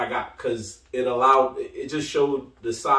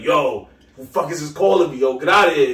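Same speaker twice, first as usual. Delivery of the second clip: louder, irritated imitation of a prank-call victim; mock annoyed; 3 semitones higher, 6 dB louder than usual. Imitating Triple H on a prank call, tight and annoyed. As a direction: put on an annoyed, loud brush-off. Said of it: "like, dumb tight".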